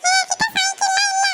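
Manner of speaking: put-on high, mildly shouted child's grumble; high kid voice. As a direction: pitch up into a bratty child complaining. Kid lies about bicycle.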